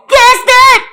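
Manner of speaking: high loud laughing yell. Real, not acted. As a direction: call out Chester with a laugh. Yelling at Chester laughing. (gülerek bağırış)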